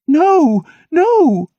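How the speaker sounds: high, loud, urgent no; panicked high-pitched refusal; hasty cry. A narrator's reading. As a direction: cry out in a rush to stop him. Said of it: "she cried hastily".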